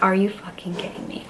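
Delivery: whisper. Retold or imitated. Dog died, disbelief.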